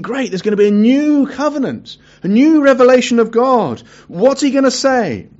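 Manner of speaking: light up like you expect fireworks from God; eager anticipation. Retold or imitated. Preacher imagining his hopes at Sinai, excited. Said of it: "I'm thinking".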